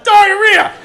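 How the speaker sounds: very high, loud one-word call back; shouted answer. Retold or imitated. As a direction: blurt it across the room. Coworker answers across the room.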